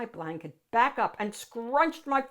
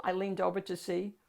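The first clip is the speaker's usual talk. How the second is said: usual speaking voice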